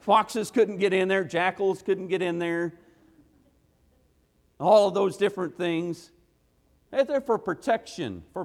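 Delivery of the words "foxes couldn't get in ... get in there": ordinary talk, nothing marked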